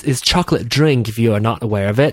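normal talking voice

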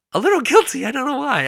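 high, loud laugh through the words. Real, not acted. Host laughing at feeling guilty over fans flying in. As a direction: giggle it out, embarrassed.

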